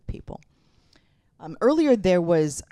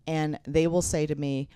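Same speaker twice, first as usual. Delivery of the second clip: ordinary talk, nothing marked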